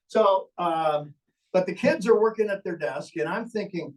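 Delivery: ordinary talk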